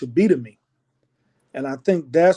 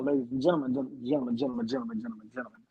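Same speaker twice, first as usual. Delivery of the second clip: fading announcer call; 9 dB quieter, much faster than usual. Acted. Mock announcer address, fading.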